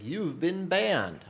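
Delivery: slow, high, hammed-up system message voice; a mock announcement of doom, slow and high; ominous ban notice. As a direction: drag it out like a dire warning. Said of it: "it's like".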